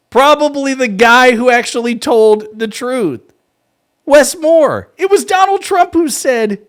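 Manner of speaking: loud, high incredulous outburst; exasperated shout. Real, not acted. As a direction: shout it in disbelief. Mocking host's question, loud outburst.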